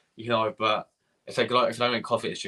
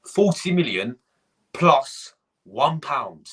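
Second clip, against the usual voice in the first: plus one pound dragged out very slowly, each word hit; mocking the Suarez bid, very slow and scornful; scornful emphasis; 3 semitones higher, 4 dB louder, much slower than usual. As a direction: spell out the insulting offer word by word.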